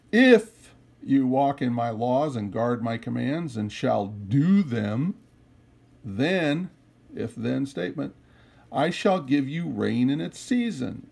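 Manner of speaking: loud, solemn reading of scripture. Acted. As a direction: proclaim the verses to the congregation. Reads scripture aloud, louder.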